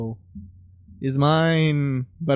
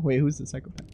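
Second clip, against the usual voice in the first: ordinary talk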